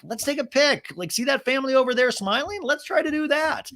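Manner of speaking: high, chirpy imitation of dad; eager suggestion. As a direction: pitch the photo idea brightly, high up. Acted dad proposing a family photo.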